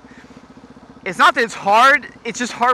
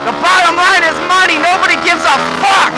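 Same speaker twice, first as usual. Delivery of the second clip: mock ranting voice; 11 semitones higher, 6 dB louder than usual. Cynical shouted rant.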